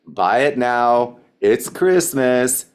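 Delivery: drawn out slowly, leaning on each word; slow insistent pitch. Retold or imitated. Kim urging a hesitant customer to buy, slow and insistent. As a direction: slowly press the holiday urgency. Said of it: "Kim said it".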